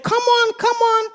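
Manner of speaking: frog calling for his sunken friend; urgent call. Acted. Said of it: "And Freddy said".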